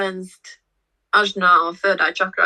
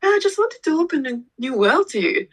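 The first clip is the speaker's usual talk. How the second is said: hurt protest; 8 semitones higher than usual. Retold or imitated. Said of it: "I was like".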